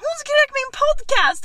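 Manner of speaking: high, thrilled near-shout; excited exclamation. Retold or imitated. Host re-enacts telling friends about the fan, excited. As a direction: burst out with the news.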